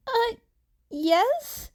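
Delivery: put-on high, hesitant teen voice; unsure he should have said it; awkward Izuku. A narrator's reading. Izuku admits they are hero students, awkwardly.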